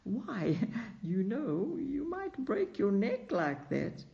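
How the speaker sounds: talking through a light laugh; amused, laughing it off; student laughing after the fright. A narrator's reading. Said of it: "said with a laugh".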